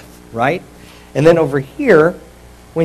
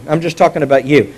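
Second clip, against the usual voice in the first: ordinary delivery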